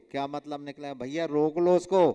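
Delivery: ordinary talk, nothing marked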